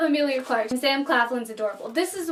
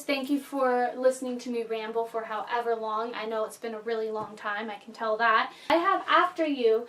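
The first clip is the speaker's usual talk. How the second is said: usual speaking voice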